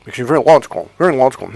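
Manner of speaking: mock nasal drone; talking through the nose. Coach imitating a man stuck in logic, nasal. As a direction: exaggerate a nose-bound voice. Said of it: "you're talking through your nose".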